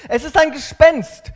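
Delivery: high, loud cry of terror; frightened cry. Acted. Disciples in the boat crying out in fear. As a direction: cry out as if you've seen a ghost. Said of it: "riefen sie".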